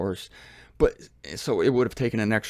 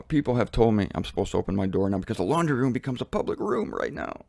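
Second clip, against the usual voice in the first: usual speaking voice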